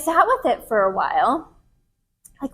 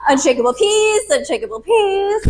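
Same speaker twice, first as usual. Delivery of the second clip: laughing mantra; 9 semitones higher, 4 dB louder than usual. Retold or imitated. Mock chanting meditation mantra.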